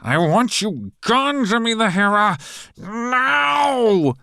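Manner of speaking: very high, loud and slow, near a cry; howling. A narrator's reading. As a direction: howl it in a frenzy. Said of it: "begins pulling at his hair and howls".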